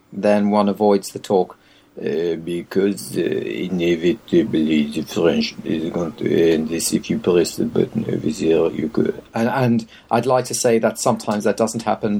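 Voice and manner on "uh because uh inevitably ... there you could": put-on low, slow French-accented English; mock French accent. Imitating a French conference speaker, mock French accent. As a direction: drone slowly in a heavy French accent. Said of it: "a French man".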